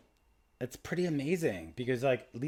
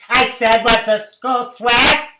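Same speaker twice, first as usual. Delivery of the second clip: loud, high, slow mock shout; hammed-up clerk yelling the question; mock shouted repeat; 12 semitones higher, 19 dB louder, much slower than usual. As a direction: bellow the question again. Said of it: "He goes".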